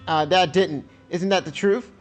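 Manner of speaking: put-on accusing teen daughter. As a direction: throw the blame at her.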